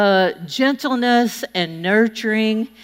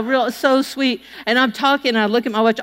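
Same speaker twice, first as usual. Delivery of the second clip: ordinary delivery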